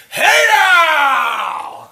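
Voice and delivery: long, high, very loud hey now; one last all-out yell. Real, not acted. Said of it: "not enough time to shout one more".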